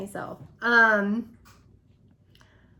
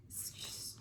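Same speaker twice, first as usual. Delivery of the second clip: shocked whisper; 23 dB quieter than usual. Retold or imitated. Stammering in shock.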